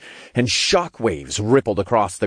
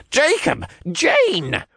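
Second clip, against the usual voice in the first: high-pitched cry of relief; relieved calling out; slower than usual. A narrator's reading. Old cultist spotting his friends, calling out with relief. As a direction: call out their names, hugely relieved. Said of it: "called out with relief".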